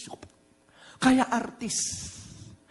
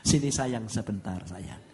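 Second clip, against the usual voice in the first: lower, softer, slow and loving; tender wife; 9 semitones lower, 5 dB quieter than usual. Retold or imitated. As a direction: gently soothe her husband as his wife. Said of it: "Dia katakan". (istri berbisik lembut menenangkan suaminya)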